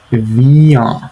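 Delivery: vient said firmly, with a nasal ah and a falling end; nasal vowel demo. Shown as a demonstration. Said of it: "make it a nasal sound".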